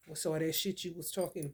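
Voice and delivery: ordinary talk, nothing marked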